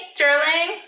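ordinary talk, nothing marked

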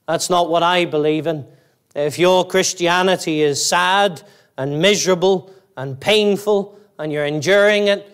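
ordinary delivery

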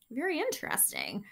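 ordinary delivery, nothing marked

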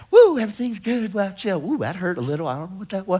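breezy, then quietly puzzled; carefree naive believer. Retold or imitated. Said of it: "We think everything's".

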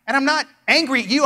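ordinary delivery

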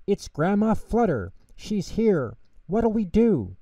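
high, frantic little voice; panicked cry. A narrator's reading. Tutter finds Grandma at the door, high panicked cry. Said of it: "cried Tutter".

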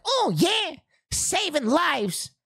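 cocky swagger. Retold or imitated. Cocky reply in drag.

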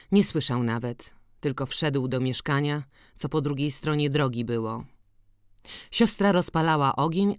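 ordinary delivery, nothing marked

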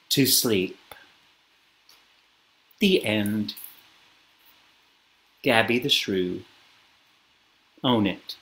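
low, soft, settling voice; hushed story ending. A narrator's reading. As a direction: bring the story gently to rest. Winding down the bedtime story.

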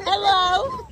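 high hello through laughter; laughing hello. Real, not acted. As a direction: laugh while calling hello. Greeting friend at door.

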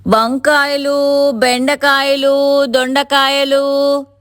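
high, long-drawn vendor's call; street hawker's cry. A narrator's reading. Seller pushing her cart through the streets, crying her wares. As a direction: stretch each vegetable out slowly, pitched high. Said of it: "గొంతు చించుకుని అరుస్తూ ఉంది".

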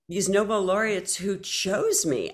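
ordinary talk

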